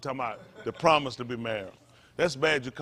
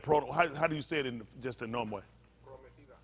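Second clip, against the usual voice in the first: ordinary delivery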